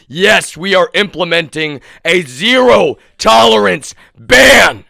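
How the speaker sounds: furious proclamation; angry shout. Real, not acted. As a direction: shout the ban at them. Angry declaration to opponents.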